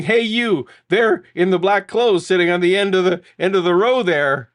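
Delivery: loud, hammed-up actor calling into the audience; retold stage yelling. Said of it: "starts yelling".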